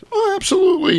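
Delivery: very high, delighted character voice; Toll Booth Willie. Retold or imitated. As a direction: agree gladly in a squeaky pitch. Willie gladly agrees to sign.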